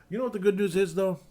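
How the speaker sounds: high, loud teasing question; teasing excitement. Real, not acted. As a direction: tease the good news brightly. Teasing setup.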